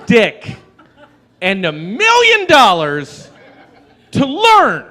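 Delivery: high, loud cracking yell; shouted punchline. Acted. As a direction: blurt it out, way up high.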